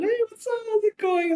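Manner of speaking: very high, gushing greeting; hammed-up party voice, easing down slightly; fake sociable cheer. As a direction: put on a bright social front, exaggerated. Acted man's cheerful social front.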